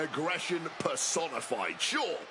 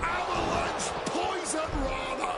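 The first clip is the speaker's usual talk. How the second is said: wrestling commentator; 9 semitones higher than usual. Acted. Calling the move name.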